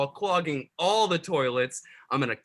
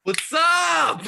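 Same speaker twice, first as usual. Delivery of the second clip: put-on sloppy drunk ghost greeting; high, loud drunk hello; 9 semitones higher, 4 dB louder than usual. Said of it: "I'm very drunk right now".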